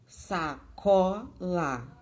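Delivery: sacola slow, CO punched between weak sa and la; stress on middle syllable. Shown as a demonstration. Teacher proving which syllable is tonic, sacola stressed on CO. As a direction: break up sacola and hit CO. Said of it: "a sílaba mais forte é có".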